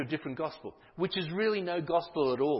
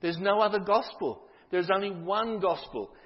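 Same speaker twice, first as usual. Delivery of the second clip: firm declaration; 5 semitones higher, 5 dB louder, slower than usual. Acted. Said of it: "He says".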